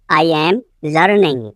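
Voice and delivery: "I am", a pause, then "learning"; split repeat. Shown as a demonstration. Teacher repeating for learners, phrase split in two. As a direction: leave a gap before "learning".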